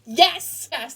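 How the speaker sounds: shouting yes at the screen; excited cheer. Retold or imitated. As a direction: cheer like you're in the theater. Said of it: "I'm like".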